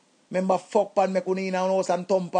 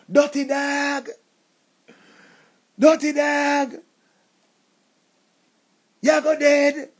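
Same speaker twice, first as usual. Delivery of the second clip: angry insult; 4 semitones higher, slower than usual. Acted. Insulting rival.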